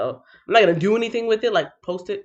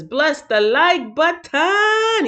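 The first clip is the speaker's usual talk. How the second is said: slow, higher, stretched ending; theatrical read-out; 8 semitones higher, 3 dB louder, much slower than usual. Acted. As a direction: draw it out with a flourish on the last word. Reads chat comment theatrically.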